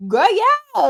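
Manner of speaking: put-on high, loud eager "Girl, yeah"; eager yes. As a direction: jump at the offer, thrilled. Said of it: "I'm like".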